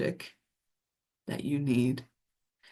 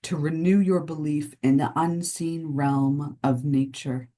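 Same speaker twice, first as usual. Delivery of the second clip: guided meditation. Acted. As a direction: lull listeners into the unseen realm of nature. Delivers a channeled nature message.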